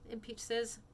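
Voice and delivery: usual speaking voice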